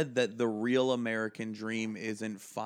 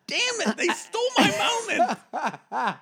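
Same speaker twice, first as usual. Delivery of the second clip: far higher, louder cry of disappointment; cheated of his revenge, loud and high; frustrated outburst; 8 dB louder than usual. Real, not acted. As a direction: wail that the moment was stolen. Boss fired before his revenge.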